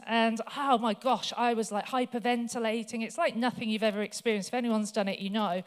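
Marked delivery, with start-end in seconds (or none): none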